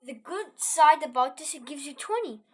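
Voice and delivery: normal talking voice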